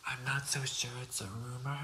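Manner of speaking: ordinary delivery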